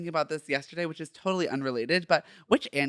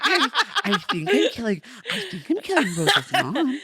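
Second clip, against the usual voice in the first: high, laughing mimic of her answer; laughing imitation; 10 semitones higher, 5 dB louder, slower than usual. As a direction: repeat her line through giggles.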